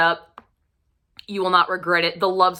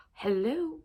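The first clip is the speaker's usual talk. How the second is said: appreciative hello; 7 semitones higher, 8 dB quieter than usual. Real, not acted. Reacting to the actor's looks.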